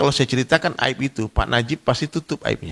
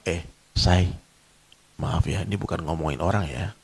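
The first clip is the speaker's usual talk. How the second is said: lower, softer, coy confiding tone; sweetly hushed gossip opener; gossiping lady; 8 semitones lower, 7 dB quieter than usual. Retold or imitated. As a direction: play a gossiping auntie pretending innocence. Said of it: "Ibu-ibu tuh kalau mau bergunjing, dia ngomongnya apa".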